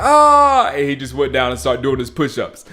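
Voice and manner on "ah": loud high crowd groan; mock crowd groan at a dropped punt. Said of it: "everyone was like".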